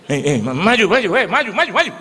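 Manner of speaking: loud, high imitated crowd shouts; urging forward. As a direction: egg someone on noisily. Retold crowd urging him forward.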